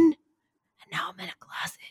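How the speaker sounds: soft unvoiced whisper; whispering on the phone. Real, not acted. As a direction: whisper it, hiding. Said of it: "why are you whispering".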